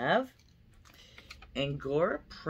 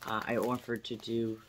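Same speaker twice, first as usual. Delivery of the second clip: normal talking voice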